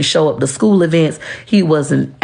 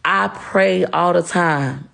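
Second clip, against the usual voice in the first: ordinary delivery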